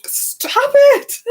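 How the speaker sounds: high, loud hammed-up outburst; mock exasperated yell. Bella telling Edward to stop, exasperated mock yell.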